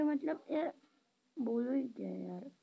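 ordinary talk

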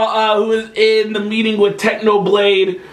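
usual speaking voice

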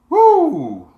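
high, loud "woo"; joyful whoop. Real, not acted. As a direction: let out a celebratory whoop. Whoops after laughing at own post.

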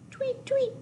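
very high tweet tweet; high birdie chirp. Pretend. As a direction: chirp like a little bird. Teacher makes bird sound.

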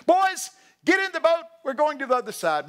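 put-on high, loud call to the boys. Jesus calling the disciples into the boat, high and loud. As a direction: shout across to the crew.

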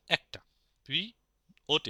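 normal talking voice

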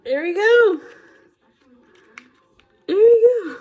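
high, loud cheer; excited triumph. Real, not acted. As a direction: call it out as a little victory. Triumph opening the product.

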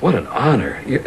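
ordinary talk, nothing marked

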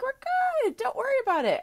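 high, cheery mock reassurance from the bank; breezy bank voice. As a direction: chirp it like an unbothered loan officer. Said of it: "Bank is like".

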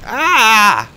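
loud, high yell of alarm; sudden high "ah"; startled scream. Real, not acted. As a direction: cry out as you crash. Crash while racing in a game.

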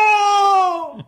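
high, loud, long "ohhh"; imitated crowd uproar. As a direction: mimic a student body erupting. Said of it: "the student body went".

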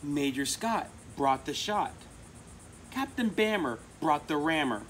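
rhythmic picture-book read-aloud; reading to children. A narrator's reading. Reader sharing a favorite book with children, rhythmic storytelling. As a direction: read the cumulative rhyme to kids, steady beat.

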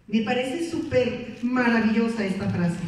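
ordinary delivery

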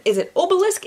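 "obelisk" as OH-belisk, with a questioning rise; pronunciation doubt. Shown as a demonstration. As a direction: try "obelisk" with a long O. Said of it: "I've never known".

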